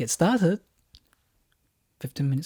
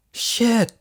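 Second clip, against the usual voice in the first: panicked curse; 8 semitones higher, 4 dB louder than usual. Acted. Panicked realization.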